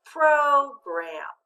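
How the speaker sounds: "Program" as PRO-gram, long o, loud high first syllable; long vowel, first-syllable accent. Shown as a demonstration. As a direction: stretch and stress PRO in "Program", then drop to gram. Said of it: "let's use that accent".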